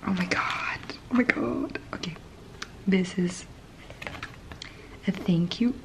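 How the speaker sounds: soft ASMR whisper; hushed unboxing whisper. Real, not acted. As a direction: breathe the words close to the mic.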